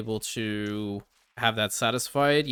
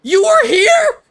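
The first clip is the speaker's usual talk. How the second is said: high startled yell, much louder; shocked shout; 13 dB louder, slower than usual. Real, not acted. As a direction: shriek it in disbelief. Streamer finally finds missing specialist.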